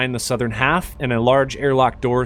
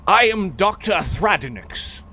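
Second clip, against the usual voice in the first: doctor character; 6 semitones higher than usual. Acted. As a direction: play a formal doctor receiving visitors. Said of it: "and he says".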